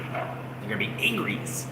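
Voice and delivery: put-on childish threat.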